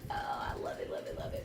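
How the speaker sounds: breathy whispered love it; whispered rave. Retold or imitated. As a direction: hush it out like a secret delight. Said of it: "I'm like".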